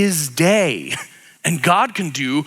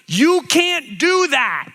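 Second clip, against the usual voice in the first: higher, outraged protest; higher, outraged religious leaders; indignant objection; 8 semitones higher than usual. Retold or imitated. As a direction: scold him for breaking the Sabbath. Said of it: "they're like, they're like".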